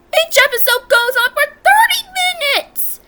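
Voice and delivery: loud, high-pitched complaint; high, ranting yell; exasperated rant. Real, not acted. Exasperated rant about episode length.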